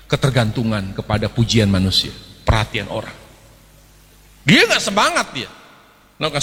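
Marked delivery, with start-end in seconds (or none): none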